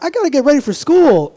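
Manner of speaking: put-on high, bright kid voice; a schoolkid excited about class; eager kid. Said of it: "This side's like".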